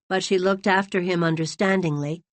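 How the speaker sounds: plain reading voice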